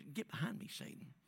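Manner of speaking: quiet, almost offhand Get behind me, Satan; hushed pretend rebuke; soft rebuke. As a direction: say it softly, like a casual aside. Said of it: "just said".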